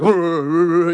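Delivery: a betta fish hyping itself up, mock whoops; fish battle cry. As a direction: puff up like a tiny fighter. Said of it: "They're like".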